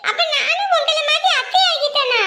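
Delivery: put-on high giggling little girl; excited child. The little girl asks if she is an aunt now, high and giggly. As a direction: laugh with a child's glee.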